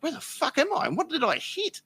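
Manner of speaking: high, bewildered cry of confusion; dazed shock. Retold or imitated. Dazed man after crashing into a picnic table, high stunned cry. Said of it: "I'm like".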